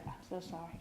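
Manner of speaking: soft, barely voiced condolence; murmured sympathy. Johnny murmuring to each mourner, very soft. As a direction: breathe it out quietly. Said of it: "Thousand times I heard".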